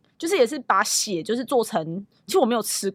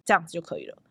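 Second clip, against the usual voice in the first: ordinary delivery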